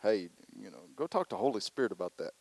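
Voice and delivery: low, quick, soft voice of God waving them off; God's brush-off. Retold or imitated. God telling the complainer to take it elsewhere, low and amused. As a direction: shoo them along lightly as God. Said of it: "God's up there saying".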